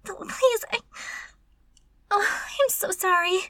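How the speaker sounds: high, loud, flustered pleading; panicked apology. Acted. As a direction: plead for forgiveness after being caught. Caught, pleading with master.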